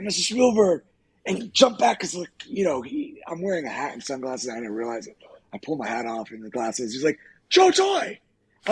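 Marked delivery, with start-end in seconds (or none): 0.0-0.8 raised, higher call to catch someone; retold hail across a distance. Acted calling out to director. 7.5-8.2 high, loud greeting by name; retold exclamation on spotting someone; delighted recognition. Acted delighted recognition, calling name.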